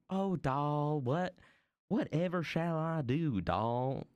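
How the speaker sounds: high, mock Southern belle drawl; Southern accent. Vlogger mimicking how Southerners talk, exaggerated Southern accent. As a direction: put on a hammy Southern accent. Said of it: "Southerners are like".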